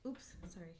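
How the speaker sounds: breathy whispered apology. Real, not acted. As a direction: whisper it sheepishly. Caught touching mic on camera.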